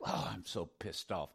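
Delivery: usual speaking voice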